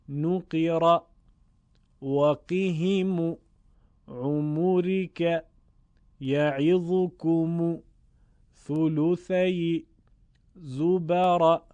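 each word slow and separate, short damma; reading examples aloud. Shown as a demonstration. Teacher reading examples aloud for students to repeat slowly.

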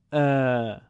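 ا held long and level; long alef. Shown as a demonstration. Teacher contrasting alef maddi with fatha, prolonged. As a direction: stretch ا to double length. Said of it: "دو برابر".

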